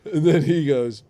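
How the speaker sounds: normal talking voice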